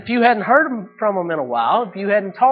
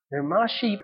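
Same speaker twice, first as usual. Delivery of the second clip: ordinary talk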